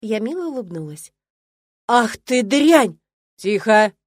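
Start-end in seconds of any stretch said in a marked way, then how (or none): 1.9-3.0 furious insult. Acted. Old woman insults the student. 3.4-3.9 much louder, sharp "Тихо!"; stern rector's loud command; barked. A narrator's reading. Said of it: "рявкнул ректор".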